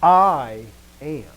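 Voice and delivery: punched, lengthened "I"; emphatic I. Retold or imitated. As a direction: lean hard on "I", let "am" drop away. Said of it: "It's emphasis".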